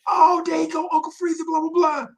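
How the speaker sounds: a high, hammed-up impression of the dude; high mock voice. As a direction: squeak it out like a teasing dude. Dude quoted greeting him.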